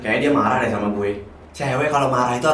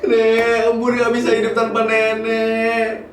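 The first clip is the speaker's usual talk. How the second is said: high, slow weeping cry; sobbing plea; 9 semitones higher, slower than usual. Acted. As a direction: cry it out, unable to let go. Weeping at grandmother's grave. (terisak-isak memanggil nenek)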